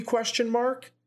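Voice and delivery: ordinary talk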